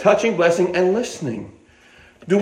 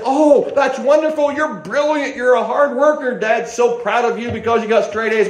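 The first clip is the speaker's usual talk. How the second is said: higher-pitched, effusive praise; gushing praise; 4 semitones higher than usual. Acted. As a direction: gush like a proud dad. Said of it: "Praise says".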